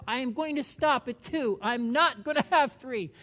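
loud, determined self-promise; mock firm resolution. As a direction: swear off the brownies. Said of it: "you said to yourself".

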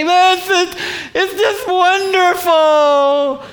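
high, loud, slow gushing of pure joy; overjoyed. Retold or imitated. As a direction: gush like he's floating. Overjoyed friend on late-night call.

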